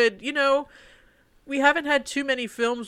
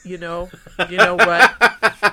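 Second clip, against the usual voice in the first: laughing; 6 dB louder, slower than usual. Real, not acted. Joking reply broken by laughter.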